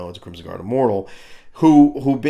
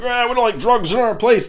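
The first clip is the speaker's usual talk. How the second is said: mock outrage; 12 semitones higher, 3 dB louder than usual. Angry neighbours ready to fight.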